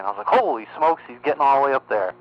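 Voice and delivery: usual speaking voice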